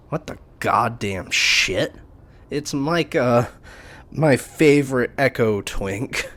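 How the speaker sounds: high, loud, slow shocked outburst; stunned disbelief. Acted. Otter spotting Micah around the corner, loud shock. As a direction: gape at him in disbelief.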